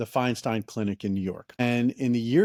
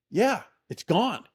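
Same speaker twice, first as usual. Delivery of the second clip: stunned; 9 semitones higher, slower than usual. Retold or imitated. Friend's asthma attack suddenly gone.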